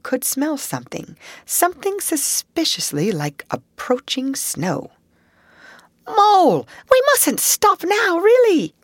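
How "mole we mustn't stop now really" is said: high, loud call over the distance; calling back. Acted. Rat calling back to Mole from far ahead, loud. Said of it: "he called back".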